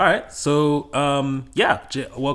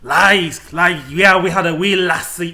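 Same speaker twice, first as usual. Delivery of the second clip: put-on Scottish accent; 3 semitones higher, 10 dB louder than usual. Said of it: "please do not flame us in the comments for that accent".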